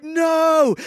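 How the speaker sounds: put-on father yelling at his toddler; shouted no. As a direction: yell it to stop her. Dad yelling as sandcastle threatened.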